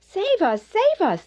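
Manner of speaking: high, pleading cry of tiny drowning ants; desperate cry for help. A narrator's reading. Drowning ants crying out to Skywind, high and desperate. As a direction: beg to be saved from the flood. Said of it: "they cried".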